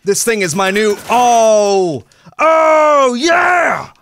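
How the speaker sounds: loud high whooping cheer; triumphant shout. Real, not acted. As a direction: scream it in victory. Triumphant kill celebration.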